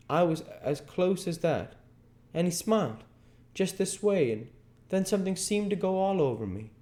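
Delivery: put-on high child's voice, hushed awe and fast; a playmate recalling the lost boy with awe; reverent child. A narrator's reading. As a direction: schoolchild telling the spot in reverent tones. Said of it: "talking in reverent tones".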